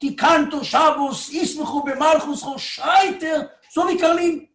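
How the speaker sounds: imitated prayer shouting, high-pitched; shouting like Karlin. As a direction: cry it out high like a fervent worshipper. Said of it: "angefangen zu schreien".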